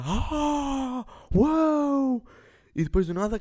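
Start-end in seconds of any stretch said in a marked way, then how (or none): 0.0-2.2 mock amazed. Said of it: "E ela".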